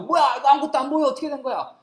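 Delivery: high, loud confused outburst; bewildered cry. Acted. Soldiers who can see nothing after Paul's power strikes, bewildered. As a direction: cry out as if suddenly blinded. Acts soldiers suddenly blinded.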